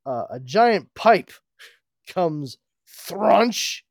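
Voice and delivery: loud slow crunching sound effect; hammy comic onomatopoeia. A narrator's reading.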